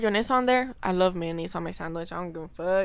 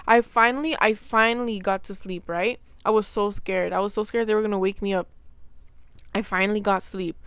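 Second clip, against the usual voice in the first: ordinary talk, nothing marked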